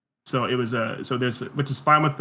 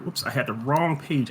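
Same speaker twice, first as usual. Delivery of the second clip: usual speaking voice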